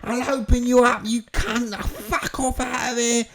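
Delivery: loud, high hammed-up rage; comic screaming fury; furious threatening. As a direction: yell threats, building to a screech. Acted threats at rivals.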